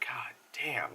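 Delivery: a shocked unvoiced whisper of Irish-free dismay; whispered god damn. Reacting to the abused boy.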